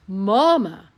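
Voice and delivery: plain reading voice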